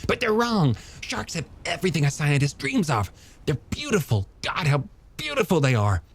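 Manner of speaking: high, animated, rhapsodic character voice; passionate young scientist. Acted. Scientist defending sharks to a doubter, high impassioned voice. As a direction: rush eagerly through the wonders, pitched well up.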